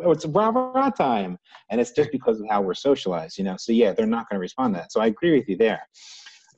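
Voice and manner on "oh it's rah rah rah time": a hint of a cheerleader's chant, rah jumps much higher; lightly mocking pep-rally call; mock rah-rah cheer. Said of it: "they just don't go".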